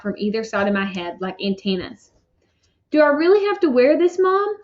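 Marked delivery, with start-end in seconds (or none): 2.9-4.6 much higher, pleading, near tears; trying not to cry. A narrator's reading. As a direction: hold back tears while begging mom. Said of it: "trying not to cry".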